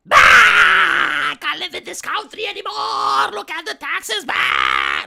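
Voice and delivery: loud, high-pitched fake wail; mock crying meltdown. TikToker retaking the crying video after a spoiled shot, fake crying. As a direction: bawl the retake just as theatrically. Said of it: "Now you have to retake".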